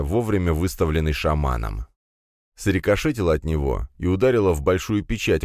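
usual speaking voice

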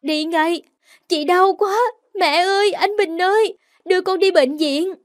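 high, loud desperate plea; frantic cry for help; pained calling out. Acted. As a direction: call for mother through the pain. Calling mother for hospital, in pain.

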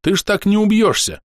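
high, mocking call; shouted taunt. A narrator's reading. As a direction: jeer it across to him. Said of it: "крикнул барсук".

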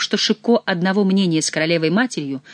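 usual speaking voice

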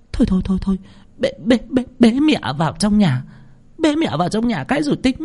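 high-pitched, stammering urgency; flustered. A narrator's reading. Wife urging husband to carry mother indoors, flustered. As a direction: hurry them along, high and panicky, repeating words. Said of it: "hốt hoảng xua tay".

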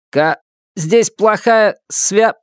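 slow, cut-off radio voice; broken radio. Acted. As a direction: fade out as the signal drops. Radio cuts out mid-word.

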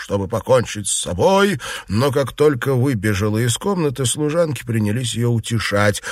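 ordinary delivery, nothing marked

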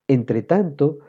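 ordinary delivery

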